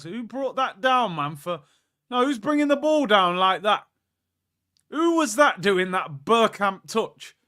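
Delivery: stunned football fan; amazed. Real, not acted. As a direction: marvel at the touch. Amazed at a touch.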